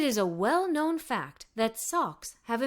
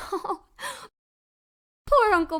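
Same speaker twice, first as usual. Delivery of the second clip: laughing; 7 semitones higher, 4 dB louder than usual. A narrator's reading. Family laughing at the sock joke, laughing. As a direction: laugh bursting out before the word. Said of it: "burst into laughter".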